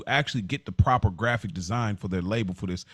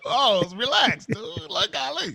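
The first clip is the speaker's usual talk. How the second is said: much higher hammed-up "pause, relax, dude"; high, jokey outrage at a double meaning; mock flustered protest; 13 semitones higher, slower than usual. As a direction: squeak it like a scandalised friend. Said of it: "I said".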